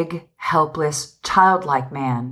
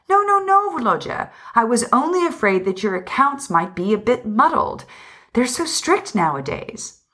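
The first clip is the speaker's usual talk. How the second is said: higher-pitched protest, leaping up on the first no; anxious reassurance; 7 semitones higher than usual. Acted. Wife to husband, denying she doubted him: anxious reassurance. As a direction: hurry to soothe him in a high, pleading voice.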